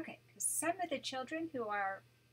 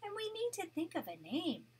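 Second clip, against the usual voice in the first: gentle read-aloud storytelling voice; storybook narration for a grandchild; story narration; 6 dB louder than usual. A narrator's reading. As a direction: read it like a bedtime picture book. Grandma reads picture book aloud.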